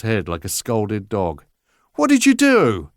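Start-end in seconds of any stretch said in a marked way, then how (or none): 1.9-2.9 high, loud accusing demand; rounding on the guilty son; furious father, much higher and louder; angry father. Acted. Father confronts son over wound.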